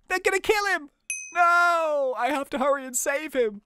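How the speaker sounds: high-pitched urgent alarm; hammed-up panic, pitched high; playful panic. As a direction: cry out as the captive is threatened. Panics as the captive is threatened.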